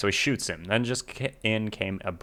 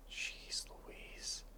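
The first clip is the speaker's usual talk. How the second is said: whispered aside; 18 dB quieter than usual. Real, not acted. Shocked by lyrics.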